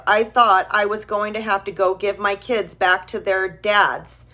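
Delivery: usual speaking voice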